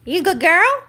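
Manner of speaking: high, loud rising praise. Real, not acted. Praising the dog.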